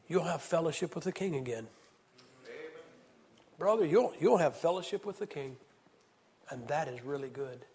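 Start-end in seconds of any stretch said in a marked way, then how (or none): none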